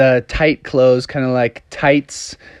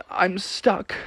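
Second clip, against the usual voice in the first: soft, higher-pitched whimper; small defeated voice; 6 semitones higher, 9 dB quieter than usual. Acted. Froggy gives up behind the locked door, quietly defeated. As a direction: say it quietly, giving up.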